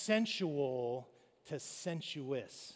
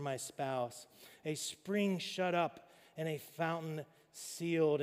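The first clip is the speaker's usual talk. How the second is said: measured, louder poetic reading; scripture reading; 2 semitones higher, 5 dB louder than usual. Acted. Pastor reads the love poem to the congregation, measured and poetic. Reads Song of Solomon aloud.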